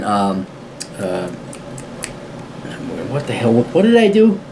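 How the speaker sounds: ordinary delivery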